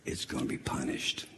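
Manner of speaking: unvoiced ominous whisper; whispered warning. Preacher on God's wrath, ominous whisper. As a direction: breathe the sentence out low. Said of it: "whispered threat".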